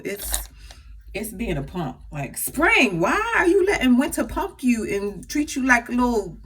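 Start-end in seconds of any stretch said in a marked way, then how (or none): 2.4-6.4 mock scolding. Real, not acted. Scolding the spring weather.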